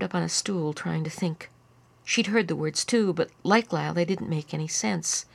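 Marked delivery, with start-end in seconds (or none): none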